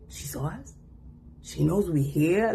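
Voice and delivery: imitating their startled surprise; mock surprise with a laugh; mock shocked. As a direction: play them caught off guard. Imagined family reaction.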